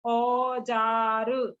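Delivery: औजार stretched very slowly; slow demo. Shown as a demonstration. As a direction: drag out औजार for listening. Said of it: "ओ का sound सुनाई दे रहा है". (औजार को बहुत धीरे खींचकर)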